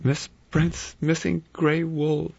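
high, slow, frightened; shaking with terror. A narrator's reading. Vera finds the wig's wool, terrified. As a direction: get it out through fear. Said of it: "her voice shook with terror".

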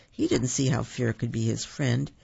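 ordinary delivery, nothing marked

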